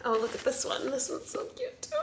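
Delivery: high, hushed adoring coo; soft cooing. Real, not acted. Mother finds another cute picture, soft cooing. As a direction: gush quietly, voice climbing high.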